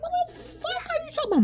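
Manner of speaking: high, fast, laughing disbelief; incredulous. Retold or imitated. Retelling her reaction to family, high laughing disbelief. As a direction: squeal it out in shock. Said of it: "I was like".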